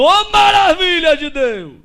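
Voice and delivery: high, drawn-out exclamation of praise; slow, high-pitched cry of wonder; retold joyful shout. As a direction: imitate someone hollering praise from indoors. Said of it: "aí gritou lá de dentro".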